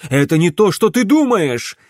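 loud, high, rising panic; frantic excuse. A narrator's reading. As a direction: blurt it out to stop her panicking. Father frantically excuses himself. (испуганное оправдание, срываясь на крик)

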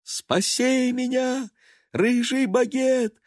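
a captive she-cat calling from below; desperate cry. A narrator's reading. Murianna crying up to Baguette for rescue, high and desperate. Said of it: "кошачий крик".

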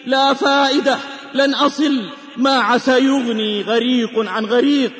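ordinary talk

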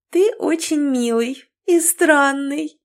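high, slow tipsy woman's giggle-voice; giggly drunk. A narrator's reading. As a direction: coo it like a tipsy flirt. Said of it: "Она пьяно хихикнула".

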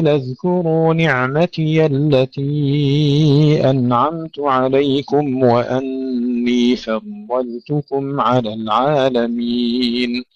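slow, measured tajwid chanting; drawn-out recitation with careful articulation; Quran recitation. Acted. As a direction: recite slowly with long held vowels.

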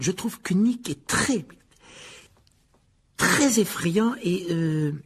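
high, slow, halting compliment; Harry, hesitant. A narrator's reading. (hésitant, voix haute et traînante)

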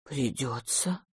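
low, very soft, slow resignation; hushed, drawn-out reluctant consent; sighing. A narrator's reading. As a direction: give in on a sighed word. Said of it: "вздохнул мистер Браун".

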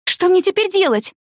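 высокий отчаянный вопрос; спроси растерянно, высоким голосом; в отчаянии. A narrator's reading. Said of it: "в отчаянии спросила я". (отчаянно)

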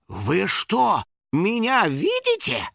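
slow, high astonished question; stunned disbelief. Acted. Finik realising people see him, astonished. Finik shocked at being seen.

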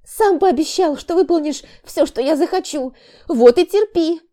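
high, loud reproach; wife reminds husband of his promise, high and loud; demanding. Acted. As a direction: hold him to his promise.

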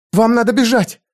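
a concubine baring her soul; earnest young woman. A narrator's reading. Zenobia urges Conan.

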